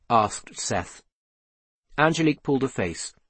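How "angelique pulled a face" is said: ordinary delivery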